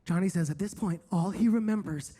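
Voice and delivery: ordinary delivery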